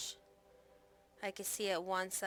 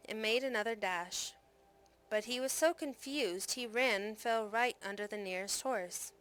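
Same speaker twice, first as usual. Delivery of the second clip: plain reading voice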